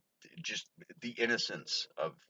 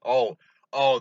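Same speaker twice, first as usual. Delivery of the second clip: loud, higher burst of remembering; oh, just remembered something; sudden loud recall; 6 semitones higher, 11 dB louder than usual. Real, not acted.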